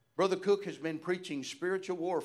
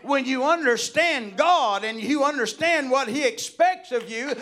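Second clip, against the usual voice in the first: high, loud preaching building to a shout; full-force preaching; 8 semitones higher, 11 dB louder than usual. Real, not acted. Preacher urging church folk to daily surrender, impassioned preaching. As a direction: cry it out to the congregation, climbing in pitch and power.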